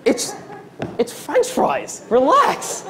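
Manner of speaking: high, squeaky disbelieving protest; slow, strained high-voiced appeal; high-pitched exasperation. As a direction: squeak it out, pleading for calm. Exasperated reply to raging customer.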